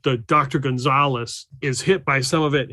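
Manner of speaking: ordinary talk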